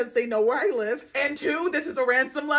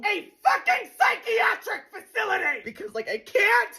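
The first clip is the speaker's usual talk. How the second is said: high despairing scream; screaming rant; 8 semitones higher than usual. Acted. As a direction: wail about dating.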